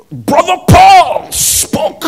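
high, loud, drawn-out Haitian preacher shout; father's passionate preaching. Retold or imitated. Son imitating pastor father preaching in Creole, passionate shout. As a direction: belt it like his Haitian pastor father, slowly. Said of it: "has a deep Haitian".

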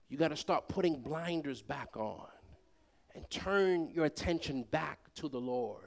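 soft, tender sermon voice; hushed preaching. Real, not acted. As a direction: drop the voice to speak from the heart. Quiet prayerful preaching.

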